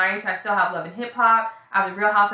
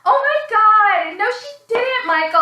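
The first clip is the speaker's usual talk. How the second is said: mock outraged wail; 12 semitones higher, 7 dB louder than usual. Girlfriend reacts to his excuse, imitated outraged wail.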